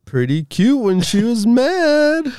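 high, slowed swooning gush. Retold or imitated. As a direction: gush over her. Voicing swooning fans.

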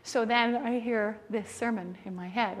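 usual speaking voice

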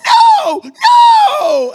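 very high, drawn-out mock scream; upset wail. Girl horrified by pastor's warning, hammed-up scream. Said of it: "she's all upset for a second".

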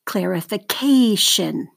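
"Clarification" slowly, CA stretched; stress on fourth syllable. Shown as a demonstration. Teacher models for seventh graders to mark, slow stressed CA in "Clarification". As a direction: say "Clarification" as clar-i-fi-CA-tion, falling after CA. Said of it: "circle the stress syllable".

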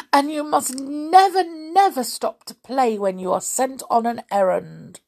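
high, emphatic storybook warning; granny laying down rules; admonishing granny. Acted. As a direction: scold gently, pushing the repeated never. Granny warns the girl.

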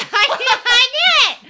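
loud, high, laughing admission. Real, not acted. Caught making up a hummingbird fact, laughing loudly. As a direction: blurt it out through laughter.

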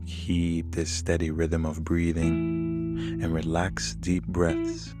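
calm and soothing over the music. Acted. Leads a guided meditation.

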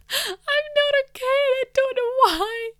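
very high, loud imitation bawling; mock tearful wail. Retelling her breakdown before the manager, hammed-up wail. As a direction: squeal it out as if crying helplessly.

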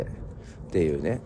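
ordinary talk, nothing marked

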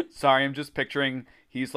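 plain reading voice

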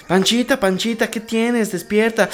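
high, worried mock mother calling Panchita; hammed-up mom shaking her kid awake; mock mother calling out the daughter's name; mother waking daughter. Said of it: "sale tu mamá".

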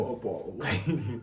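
slow, drawn-out "What about?"; mock catchphrase delivery; odd imitated catchphrase. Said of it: "I heard somebody say it before".

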